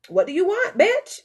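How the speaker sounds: high, loud, cheeky challenge with a laugh; mock-rude question cracking up; sassy laughing jab. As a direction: poke at your own heart, half laughing. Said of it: "I'm gonna ask my heart".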